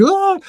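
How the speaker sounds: very high-pitched little cry of fright; mock scared "ah". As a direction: imitate a frightened fan, voice shooting up. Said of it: "It was scary".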